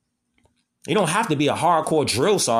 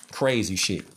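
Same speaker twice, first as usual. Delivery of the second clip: ordinary talk, nothing marked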